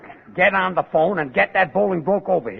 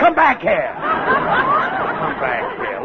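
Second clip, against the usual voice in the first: higher, louder, dragged-out call; calling after him; 11 semitones higher, 4 dB louder, much slower than usual. Acted. Burns yells after Cue Ball, high and drawn out. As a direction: call him back, stretching it out.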